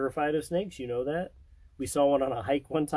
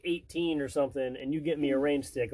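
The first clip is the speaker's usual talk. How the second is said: ordinary delivery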